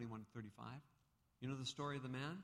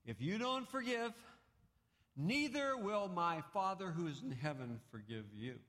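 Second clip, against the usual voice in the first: raised, louder proclamation; solemn warning; 7 semitones higher, 6 dB louder than usual. Retold or imitated. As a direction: declare the warning gravely. Quoting Jesus with force.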